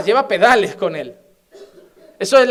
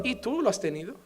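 quiet reply; 10 dB quieter than usual. Acted. Son answers father back quietly.

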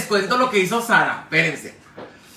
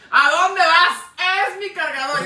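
mock hysterical; 8 semitones higher than usual. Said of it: "Y yo bien histérica".

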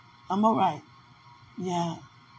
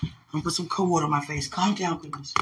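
ordinary talk, nothing marked

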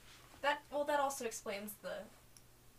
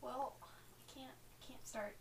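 quiet muttering; 9 dB quieter than usual. Acted. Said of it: "Just like".

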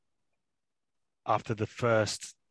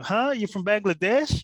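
imitating a waitress mishearing, much higher and louder with a laugh; a confused woman, loudly and high, amused; baffled mishearing; 13 semitones higher, 11 dB louder than usual. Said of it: "and she went".

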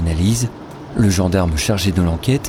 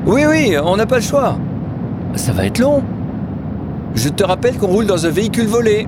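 much higher, quick, edgy reply; higher, tense woman; 13 semitones higher than usual. Acted. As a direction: snap back a reminder. Other woman answers curtly. (voix de femme aiguë et tendue)

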